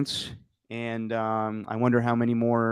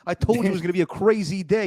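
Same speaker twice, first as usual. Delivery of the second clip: high, bright-voiced fan impression; high excited mock fan voice; mock cheerful guy; 7 semitones higher than usual. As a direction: act the guy showing off to the camera. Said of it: "turned around like".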